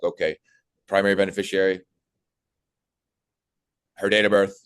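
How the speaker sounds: cold, by-the-book question; just read the form field off the script; flat scripted read. Shown as a demonstration. Sales trainer showing the wrong way to ask a client, flat and scripted. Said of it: "No, don't do that".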